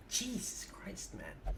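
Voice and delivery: slow whispered exasperated sigh; whispered exasperation. Real, not acted. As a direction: breathe it out in disbelief. Exasperated under breath.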